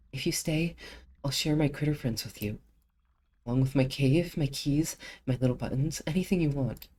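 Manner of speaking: lightly voiced childlike creature; offering creature. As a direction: bribe her with treasures.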